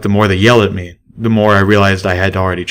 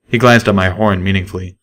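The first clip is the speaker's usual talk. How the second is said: usual speaking voice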